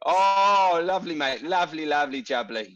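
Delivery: put-on London accent. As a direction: gush like a mock London geezer. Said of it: "so Steve feels like he's at home".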